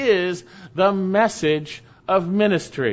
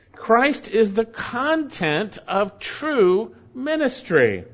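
ordinary talk, nothing marked

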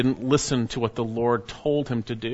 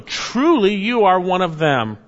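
accusation; 6 semitones higher, 5 dB louder than usual. Acted. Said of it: "the bystanders came up and said to Peter".

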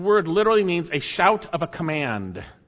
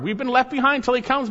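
usual speaking voice

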